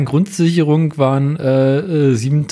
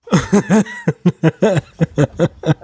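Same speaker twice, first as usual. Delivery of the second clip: giggly rising laugh; 4 semitones higher than usual. Real, not acted. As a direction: laugh at your own joke. Teller laughs at own joke.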